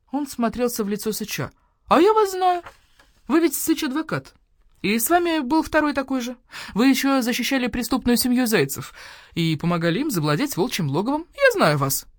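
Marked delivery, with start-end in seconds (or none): 1.9-12.1 put-on higher, excited young accuser; young badger-cat, worked up; eager young badger-cat.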